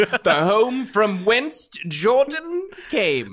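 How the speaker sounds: high, slow, grand announcing voice; grand tour guide. Pretend. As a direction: announce like a solemn plaque.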